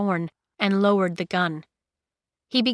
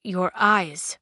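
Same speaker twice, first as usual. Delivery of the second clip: lightly voiced thick accent; stranger's accented English. A narrator's reading. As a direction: speak with a foreign accent. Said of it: "in a thick accent".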